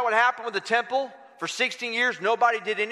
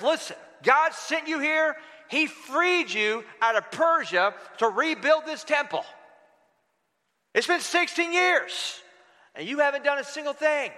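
raised pitch, a touch of reproach; lightly voiced prophet; 5 semitones higher than usual. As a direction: deliver a prophet's rebuke, pitched up. Prophet rebukes people in God's name.

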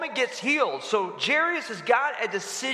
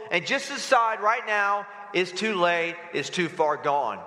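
ordinary delivery

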